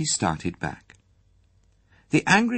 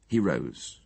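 ordinary delivery

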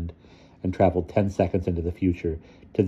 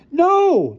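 very high, loud outcry; bellow; 7 dB louder than usual. A narrator's reading. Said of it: "Tycho bellowed".

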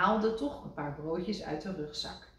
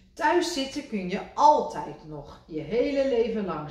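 louder, lecturing; Rad lecturing; 4 semitones higher, 6 dB louder than usual. A narrator's reading. Rad to Kikker, louder lecture. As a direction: brush it off firmly. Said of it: "zei Rad".

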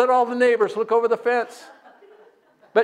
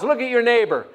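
ordinary talk

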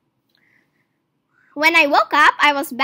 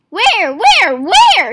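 much louder, higher panicked "where"; frantic cry; 6 semitones higher, 9 dB louder, much slower than usual. A narrator's reading. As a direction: cry out frantically, searching blindly. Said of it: "he cried".

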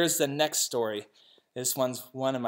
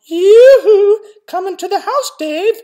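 loud, much higher sing-song call; 16 dB louder than usual. Acted. Mrs. McCabe calls a son into the house, loud and high. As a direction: call across the yard to the children. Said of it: "when she wants one and calls".

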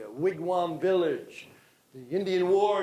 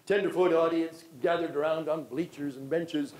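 ordinary talk, nothing marked

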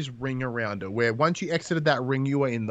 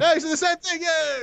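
mock high voice. Mimicking the cat's greeting.

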